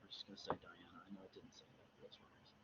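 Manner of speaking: hushed, unvoiced admission; whispered aside. Real, not acted. Reader confessing a guessed name to listeners, whispered.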